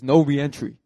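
loud, firm bouncer order; firm refusal. Retold or imitated. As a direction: shut it down in a loud voice. Bouncer first refuses him entry.

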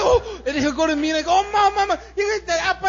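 put-on high, shouted kid voice; loud hammed-up child excitement; excited child. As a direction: imitate a thrilled kid squealing to parents. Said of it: "He gets so excited".

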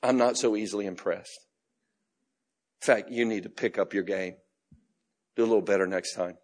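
lightly voiced unimpressed father; a hint of a stoic, distant father. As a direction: say it like an aloof dad, hard to impress. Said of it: "a stoic, distant father that goes".

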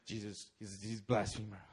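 seething, breathy low threat; breathing threats. Retold or imitated. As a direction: spit it through heavy breaths. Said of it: "he's breathing threats".

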